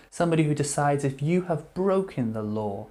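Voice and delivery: normal talking voice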